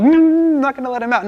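high, loud mock refusal with a long "Mm"; long high hum of refusal, then protest; stubborn whale. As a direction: play a balking whale, hammed-up. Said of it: "The, the whale didn't fight God".

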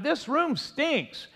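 high, drawn-out grumble of outrage without real volume; mock shout. Preacher playing grandpa woken by a stinky smell, mock shouting. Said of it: "staggered out of the bedroom and shouted".

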